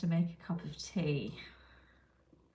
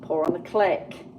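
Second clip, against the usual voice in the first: loud rule; 9 dB louder than usual. Shown as a demonstration. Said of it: "part of the tutorial".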